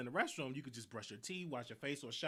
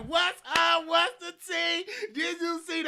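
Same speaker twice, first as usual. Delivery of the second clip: much higher and louder, overdone chummy small talk; talking all extra; 11 semitones higher, 10 dB louder, slower than usual. Retold or imitated. Chatting up roommate's boyfriend, all extra and overdone. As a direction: pour on the fake enthusiasm. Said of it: "I'm just talking all extra".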